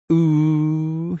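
high, drawn-out gleeful jeer; taunting. A narrator's reading. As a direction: tease like a gloating schoolkid. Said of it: "he taunted".

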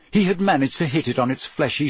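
ordinary delivery

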